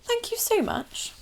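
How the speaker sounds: high, soft, mincing thank-you; a touch of sugary politeness. Acted. Courtier thanking the Queen softly. Voiced character in story.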